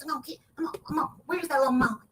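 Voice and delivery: a lightly voiced mocking impression, slightly fast. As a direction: mimic him teasing, the timbre a little off her own. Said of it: "Lil Fine Wrong talks like this".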